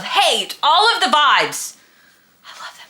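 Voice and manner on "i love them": whisper 1. Real, not acted.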